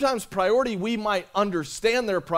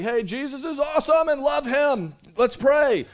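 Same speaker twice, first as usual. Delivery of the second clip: high, louder pep-talk parody; mock fluffy hype; 8 semitones higher, 4 dB louder than usual. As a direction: hype them up with empty cheer, pitched high. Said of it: "fluff you up with a bunch of things like".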